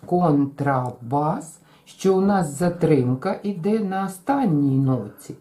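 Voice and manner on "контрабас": контрабас very slow, last syllable held; two quick beats then hold БАС; контрабас with a long final БАС; rhythm demo. Shown as a demonstration. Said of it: "промовимо це слово і подивимось".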